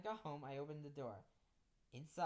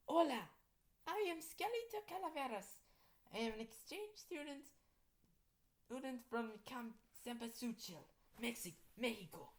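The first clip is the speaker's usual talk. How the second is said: high-pitched skeleton exchange student; skeleton girl; 9 semitones higher than usual. Acted. Exchange student from Mexico introduces herself, high skeleton girl voice. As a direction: introduce yourself in a high put-on voice. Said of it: "the skeleton girl said".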